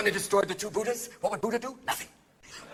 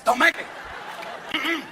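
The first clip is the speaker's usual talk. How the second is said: mock threat; 6 semitones higher than usual. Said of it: "Don't make me go".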